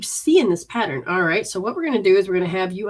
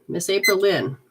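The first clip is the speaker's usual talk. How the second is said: normal talking voice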